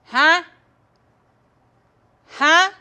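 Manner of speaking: imitating dad's loud, high, rising huh; uninterested dad's huh. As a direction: cut in with a loud questioning grunt. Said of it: "More out of lost interest than lost hearing".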